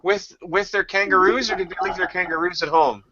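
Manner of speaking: put-on high, giggly child question; kid voice. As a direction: ask it dead earnest like a small boy. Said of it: "I said".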